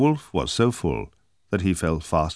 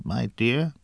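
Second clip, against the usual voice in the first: "dear" drawn out on a rising, higher mock-frail croak; wolf posing as grandmother; 7 semitones higher than usual. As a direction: play the sweet fake granny on "my dear". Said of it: "croaked a faint voice".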